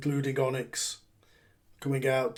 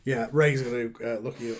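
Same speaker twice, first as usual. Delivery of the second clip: ordinary delivery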